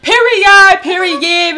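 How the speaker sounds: loud, high shout of period; hyped catchphrase. Real, not acted. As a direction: belt out period with hype. Hype catchphrase intro.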